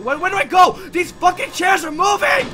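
loud, high panicked shouting; terrified horror victim. Real, not acted. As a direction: shout while fleeing in panic. Chased, chairs moving.

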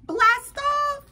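high, loud launch cry; blast-off call. Acted. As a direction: call it out like a rocket liftoff. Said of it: "Or as we would say".

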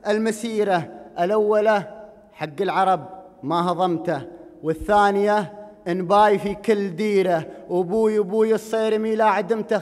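declaimed Gulf Arabic poem, measured and rhythmic; projected verse with drawn rhyme endings; poetry recitation. Acted. Recites his poem to audience.

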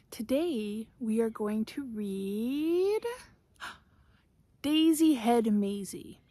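ordinary delivery, nothing marked

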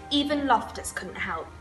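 ordinary delivery, nothing marked

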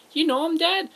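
high-pitched little son asking dad; a kid peering up at his dad. Acted. Little son asks his dad, kid voice.

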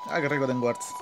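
ordinary talk, nothing marked

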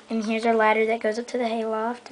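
usual speaking voice

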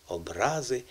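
"образи" with РА strong; "образи" as обРАзи, middle syllable stressed; stress on second syllable. Shown as a demonstration. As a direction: lean on РА in "образи". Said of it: "наголос ми поставили".

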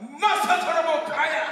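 high, drawn-out full shout; shouted mock tongues. Nephew faking tongues at morning devotion, drawn-out shout. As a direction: bellow it slowly like fervent tongues. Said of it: "me I shout".

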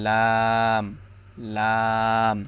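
long, held লাম with tongue edge by upper teeth; slow demonstration of লাম from the tongue's edge; tongue-edge letter. Shown as a demonstration. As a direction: draw লাম out, tongue-tip edge at the tooth roots. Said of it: "দাঁতের গোড়ার সঙ্গে লাগিয়ে".

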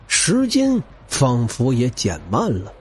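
ordinary talk

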